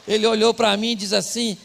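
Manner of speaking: loud, high, driving preacher voice; revved-up preacher storytelling; full-force preaching. Acted. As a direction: belt it out to the congregation.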